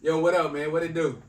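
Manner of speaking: loud, punchy homeboy hello; rugged street greeting. Shown as a demonstration. Mock-greeting a customer without phone voice, rugged. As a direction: blurt it like greeting the guys.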